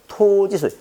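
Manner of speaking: 토 in 토지 stretched long and pitched high; memorable emphatic reading. Shown as a demonstration. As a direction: start high and draw out 토 of 토지. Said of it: "이렇게 읽어야 됩니다".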